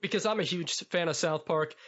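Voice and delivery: ordinary talk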